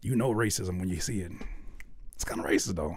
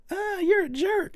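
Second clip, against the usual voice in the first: high-pitched, drawn-out sitcom complaint; mock sitcom outrage; 4 dB louder, slower than usual. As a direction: whine it slowly like a nineties TV victim. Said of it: "in T V shows ... they be like".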